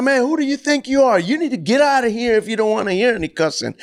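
high-pitched, heated mock comeback; indignant retort. Coach answering the man who scolded him, indignant retort. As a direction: act out an affronted coach pushing back. Retold coach wanting to fight.

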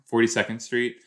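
ordinary talk, nothing marked

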